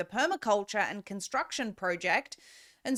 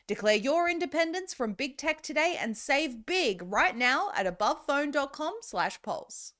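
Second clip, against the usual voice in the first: higher, punchy call to action; ad read; 5 semitones higher than usual. Acted. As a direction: push the listener to buy now.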